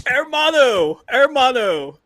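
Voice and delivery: loud yelled quote; movie line shout. Retold or imitated. Quoting the movie to his friend, loud call.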